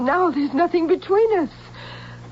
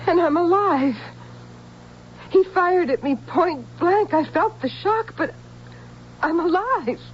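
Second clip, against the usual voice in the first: high-pitched, halting amazement; amazed realization; 6 semitones higher than usual. Acted. Una finding herself unhurt after the shot, amazed. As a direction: marvel at having survived, pausing.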